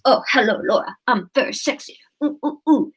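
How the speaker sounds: hammed-up breathy flirtation; sexy man act with cooing oohs. Shown as a demonstration. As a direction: put on a mock-seductive voice for a listener. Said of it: "That's my sexy voice".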